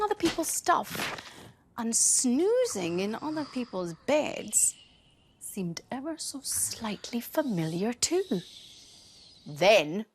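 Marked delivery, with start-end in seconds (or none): none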